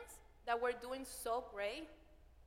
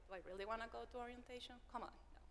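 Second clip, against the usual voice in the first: quick, hushed grumble fading to a whisper; muttered reluctance; 10 dB quieter, much faster than usual. Retold or imitated. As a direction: mumble it to yourself, not wanting to go. Retelling reluctant inner thought.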